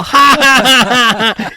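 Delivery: high loud fake laugh; high loud mock laugh; mocking laughter. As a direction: imitate ladies laughing at you. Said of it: "they're all".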